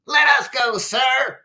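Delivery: high-pitched sneering character voice. A narrator's reading. Roommates urge their commander to let them go, sneering. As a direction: sneer it up high like a cartoon henchman. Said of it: "sneered Pox's roommates".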